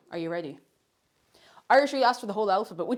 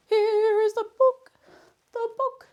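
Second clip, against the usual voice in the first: much higher, slow, proud announcement; grand reveal; 12 semitones higher, 6 dB louder, slower than usual. Pretend. Showing the book to viewers, high slow reveal. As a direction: present it like a trophy.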